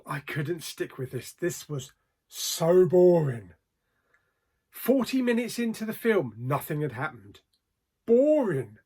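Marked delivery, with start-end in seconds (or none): 1.3-3.5 exasperated boredom. Real, not acted. Exasperated verdict on a dull film. 8.1-8.8 emphatic verdict. Real, not acted. One-word verdict on the film.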